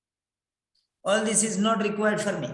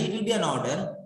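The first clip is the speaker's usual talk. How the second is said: ordinary talk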